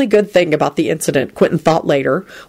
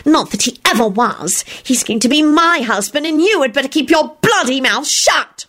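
higher, fierce snarl; ferocious snarl; 7 semitones higher than usual. A narrator's reading. Solange threatening Loretta, fierce snarl. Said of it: "she snarled ferociously".

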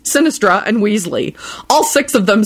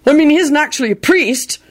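lame; 8 semitones higher than usual. Acted. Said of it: "he said lamely".

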